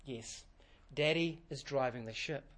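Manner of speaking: put-on soft, reassuring mother to her child; calm the little girl gently; smiling mother. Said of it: "smiles and says".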